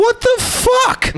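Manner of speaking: high, slow, drawn-out disbelief; stunned disbelief. Retold or imitated. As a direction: stretch it out in shock. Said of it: "I was like".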